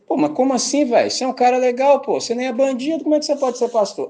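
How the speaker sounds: mock incredulous guy. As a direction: play a baffled guy in disbelief. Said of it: "eu falo". (voz aguda e alta de espanto debochado)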